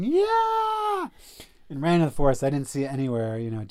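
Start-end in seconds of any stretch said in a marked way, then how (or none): 0.0-1.1 very high, loud whoop; exultant yell. Retold or imitated. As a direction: let out a wild cry of triumph. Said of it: "just going".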